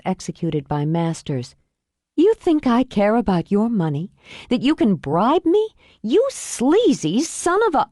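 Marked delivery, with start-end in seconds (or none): 2.2-7.9 mounting rage, snapping at him; furious outburst. Acted. Olivia furious at bribe.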